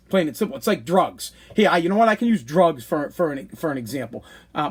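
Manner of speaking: ordinary delivery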